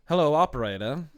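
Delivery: put-on like an old Hollywood switchboard call in mid-Atlantic accent. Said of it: "They had that kind of, mid-Atlantic accent".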